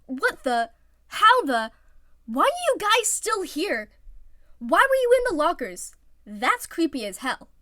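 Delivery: loud, high stammering disbelief; stammered shock. Acted. Iwazumi discovering hidden teammates, shocked stammer. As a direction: sputter in shock. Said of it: "Iwazumi stammered".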